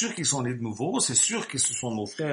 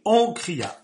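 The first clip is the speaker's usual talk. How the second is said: cry out; 4 semitones higher, 6 dB louder than usual. Acted. Preacher voices the midnight cry.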